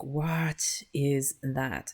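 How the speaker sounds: very slow, drawn-out imagined reaction; imitating a baffled passer-by, dragged right out; mock bafflement. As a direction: stretch each word out in mock disbelief. Voicing a woman in photo.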